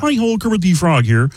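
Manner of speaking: cheery Muppet greeting through the nose; Kermit the Frog. Acted. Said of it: "Kermit the Frog is a nasal voice".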